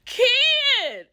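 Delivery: loud, high delighted call; excited shout. Real, not acted. Fan shrieking the coach's name with joy.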